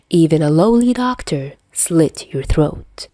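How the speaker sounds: ordinary delivery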